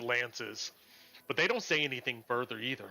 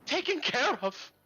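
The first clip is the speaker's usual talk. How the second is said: bitter angry woman; 10 semitones higher than usual. Acted. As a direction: spit her distrust at the paladins. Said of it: "One of the ladies ... goes".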